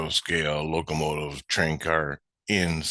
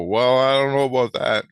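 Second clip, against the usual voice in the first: higher, louder mock hesitation; retold doubtful reply; 6 semitones higher, 5 dB louder than usual. Train maker answering the kid, retold loud doubt. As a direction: push back with a raised, drawn-up voice. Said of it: "And I go".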